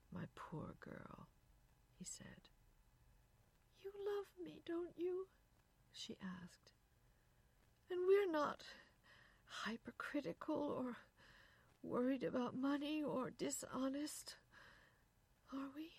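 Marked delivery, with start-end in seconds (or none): 0.1-1.3 soft, slow consoling murmur; hushed sympathy; tender. A narrator's reading. Husband comforting crying wife. 3.8-5.3 high, anxious little plea; plaintive, high-pitched question; pleading. A narrator's reading. Wife begging for reassurance. 7.9-15.9 high, hesitant appeal; plaintive, anxious questioning; pleading. A narrator's reading. Wife begging for reassurance.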